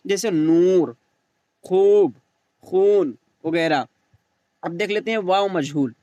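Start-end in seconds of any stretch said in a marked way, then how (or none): 0.3-3.2 long drawn-out oo in "नूर, खूब, खून"; slow teacher's "नूर" with a stretched oo; stretched waw. Shown as a demonstration. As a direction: hold the oo in each word, "खूब". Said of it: "उसे खींच कर पढ़ा जाए".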